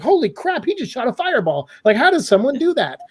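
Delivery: high, awed gasp of a wowed moviegoer; amazed viewer. Retold or imitated. A viewer seeing a fireball, high and amazed. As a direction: sell the wonder. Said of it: "a person who's watching a movie would say".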